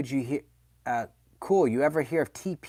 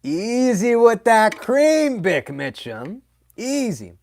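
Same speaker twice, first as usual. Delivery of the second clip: slow, higher, drawn-out tease; slow mock warning; 8 semitones higher, 7 dB louder, slower than usual. Streamer teasing Bick about his cream, slow and drawn out. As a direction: stretch the warning out, ribbing a friend.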